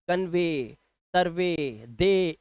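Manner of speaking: कन्वे, सर्वे, दे each ending in long "ay". Shown as a demonstration. Teacher recapping the V-EY rule with कन्वे, सर्वे, दे, long ay. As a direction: slowly stretch the ay in कन्वे, सर्वे, दे. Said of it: "इस तरह से आप इसको पढ़ते हैं".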